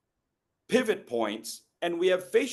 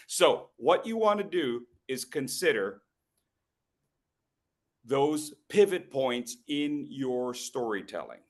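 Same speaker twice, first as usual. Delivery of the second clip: normal talking voice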